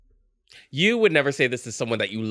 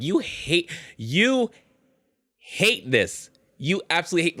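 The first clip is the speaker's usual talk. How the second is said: incredulous; 7 semitones higher than usual. Retold or imitated. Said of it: "I'll be like".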